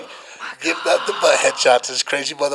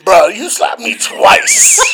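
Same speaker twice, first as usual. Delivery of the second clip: high, loud, drawn-out complaint; re-enacted indignant yell; 7 semitones higher, 8 dB louder, much slower than usual. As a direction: yell it in aggrieved disbelief. Re-enacting yelling at friend.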